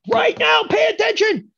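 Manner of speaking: high-pitched, very loud yell; sudden attention shout. Acted. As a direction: shout to jolt the class awake.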